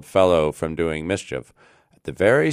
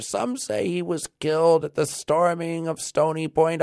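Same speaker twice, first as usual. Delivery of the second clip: put-on high-pitched old man telling news; thin piping old man; 11 semitones higher than usual. A narrator's reading. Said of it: "an old man replied in a thin, piping voice".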